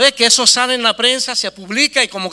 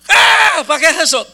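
high, loud mock scream; retold outburst; 8 semitones higher, 7 dB louder than usual. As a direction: explode in hammed-up outrage. Imita el estallido del socio. (grito exagerado del socio indignado)

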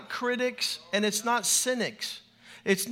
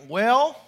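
"Well" higher, rising and louder; "Well" as a raised, rising response; questioning WELL; 3 semitones higher, 6 dB louder than usual. Shown as a demonstration. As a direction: lift "Well" like a question to the preacher. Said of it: "you're like".

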